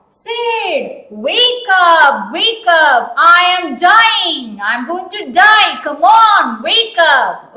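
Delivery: hammed-up dying plea, loud and high; loud cry. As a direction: wail it out so he wakes. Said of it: "He cried aloud".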